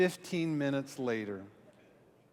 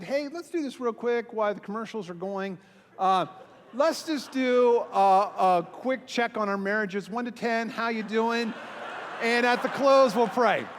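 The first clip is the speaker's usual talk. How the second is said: chirpy pastor; 6 semitones higher, 5 dB louder than usual. Retold or imitated. Pastor cheerily quizzes couples mid-game.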